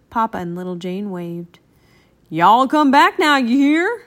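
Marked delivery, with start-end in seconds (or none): 2.3-4.0 loud, high, folksy holler; high-pitched friendly shout; shouted farewell. A narrator's reading. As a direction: holler an invitation after departing friends. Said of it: "shouted Bubba".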